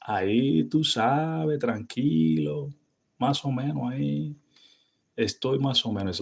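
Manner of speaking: high, slow Dominican drawl on "tranquilo"; Dominican casual. Shown as a demonstration. Answering how you are, laid-back Dominican Spanish. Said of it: "Now you said".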